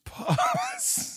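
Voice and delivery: breathy laughing squeak. Real, not acted. Laughing echo of pause call.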